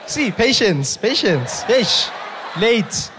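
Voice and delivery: high, loud scolding with falling tones; mock exasperation. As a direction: play exasperated at the latecomers. Mock scolding of late audience members.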